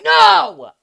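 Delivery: very high, very loud "no" scream; over-the-top shriek of protest; dramatic scream. Pretend. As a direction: scream it out in mock despair. Panics as phone is about to die.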